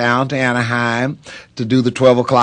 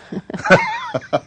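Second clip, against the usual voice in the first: short high laugh. Real, not acted. Laughing to the host at the end. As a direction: laugh at your own joke. Laugh after signing books joke.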